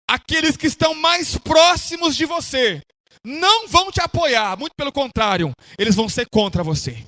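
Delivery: fervent pulpit exhortation; impassioned preaching. Real, not acted. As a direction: preach it to the congregation with stretched key words. Preaches at full force.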